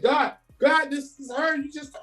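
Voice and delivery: as if you can't believe she's real; high, tearful disbelief; overwhelmed crying. Retold or imitated. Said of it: "and I instantly start crying".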